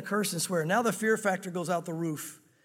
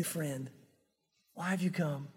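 lower, softer, gentle; tender greeting; 5 semitones lower, 6 dB quieter, slower than usual. Acted. Jesus greeting Judas, low and gentle. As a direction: welcome him softly.

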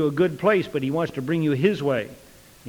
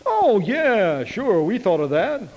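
drawn-out sarcastic agreement, pitched up; mock agreement; 5 semitones higher, 3 dB louder, slower than usual. People claiming they had thought of God's plan, sarcastic. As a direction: swoop up high and stretch it sarcastically.

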